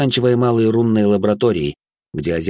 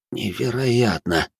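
slow, drawn-out amazement; awed disbelief; 3 semitones higher, much slower than usual. A narrator's reading. Doctor Matias facing mysterious Kel devices, awed disbelief. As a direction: stretch the word out in wonder.

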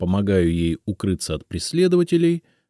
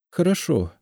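ordinary delivery, nothing marked

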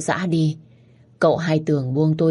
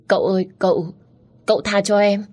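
frightened, halting plea in a higher voice; stammering plea; 4 semitones higher than usual. A narrator's reading. Pinned narrator begs the young master, stammering. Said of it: "Tôi lắp bắp".

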